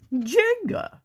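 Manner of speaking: a high, loud excited cry; excited shout. Acted. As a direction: squeal the title with glee. Excited movie pick.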